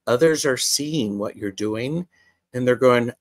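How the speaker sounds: ordinary talk, nothing marked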